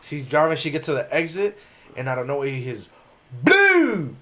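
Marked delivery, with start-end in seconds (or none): 3.4-4.2 high loud burst noise; imitated blowout. Tire blows at exit, loud high pop.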